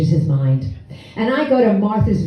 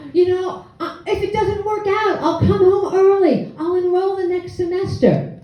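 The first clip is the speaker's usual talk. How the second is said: much higher, giggling through a sugary promise; laughing sweet talk; 10 semitones higher than usual. Retold or imitated. Daughter coaxing her father through laughter, much higher and giggly. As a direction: laugh it off sweetly to sell the lie.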